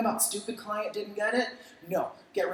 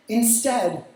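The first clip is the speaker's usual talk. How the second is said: ordinary talk, nothing marked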